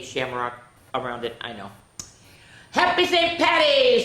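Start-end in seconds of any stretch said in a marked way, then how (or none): 2.7-4.0 high, loud, happy hail; cheerful greeting. Acted. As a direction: call out a festive greeting. Dad's greeting in story.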